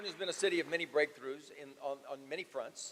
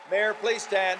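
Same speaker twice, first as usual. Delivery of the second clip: calling out; 7 semitones higher, 13 dB louder, slower than usual. Real, not acted. Calls mayor to stand.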